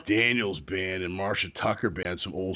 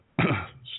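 rough throat clear. Real, not acted. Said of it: "sorry for my rough voice today".

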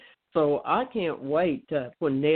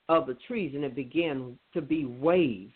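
ordinary delivery

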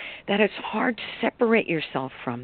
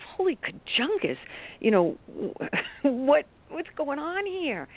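much higher, slowed, half-laughing exclamation; exasperated disbelief; 9 semitones higher, slower than usual. Retold or imitated. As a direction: throw up your hands at a second mess. Second paint spill, exasperated thought.

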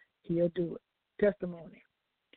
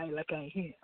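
usual speaking voice

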